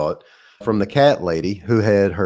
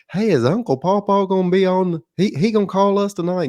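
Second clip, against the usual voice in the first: put-on high little-kid voice, eager question; kid's voice pitched much higher; child's voice; 9 semitones higher than usual. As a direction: play the small child asking about his uncle. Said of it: "one of the kids looks over at me and says".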